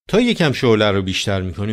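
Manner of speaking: usual speaking voice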